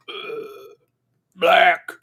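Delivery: lightly voiced zombie ordering coffee. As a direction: groan it out like a half-hearted zombie. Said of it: "Pretend to be a zombie ordering coffee".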